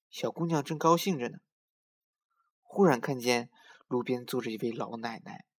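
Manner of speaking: usual speaking voice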